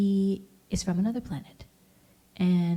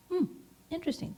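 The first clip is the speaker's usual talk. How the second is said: mock interest; 10 semitones higher, 7 dB quieter, much faster than usual.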